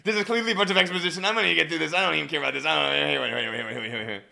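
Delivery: rattled off fast and loud; speed-read exposition, tumbling into a babble; blazing talk speed. Acted. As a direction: race through it, running the words together. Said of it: "We are blazing with our talk speed".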